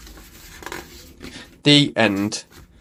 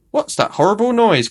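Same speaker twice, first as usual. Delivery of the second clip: cries out; 9 semitones higher than usual. A narrator's reading. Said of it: "cries Missus Corgi".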